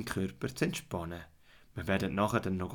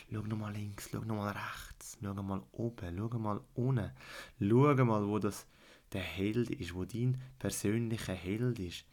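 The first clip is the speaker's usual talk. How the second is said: guided fantasy journey. Acted. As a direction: calmly lead a child into relaxation.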